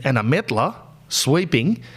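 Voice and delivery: usual speaking voice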